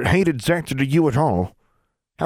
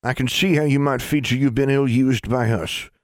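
reassuring; 6 semitones higher than usual. A narrator's reading. Granny reassures Alexandra.

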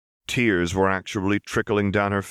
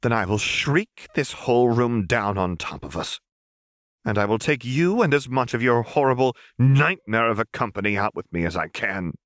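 louder, higher furious threat; 5 semitones higher, 4 dB louder than usual. Acted. Seraphine threatens Renata, rising fury. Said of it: "Seraphine bit out".

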